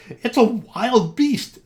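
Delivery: high, loud, slow frightened shout; terrified cry. Acted. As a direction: scream it at the scary creature. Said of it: "they cried".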